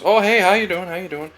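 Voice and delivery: high, bright, rushed hey with no real interest; hollow autopilot greeting. Retold or imitated. Mimicking everybody's empty greeting to the barista. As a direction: toss it off like everyone does. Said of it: "everybody just goes".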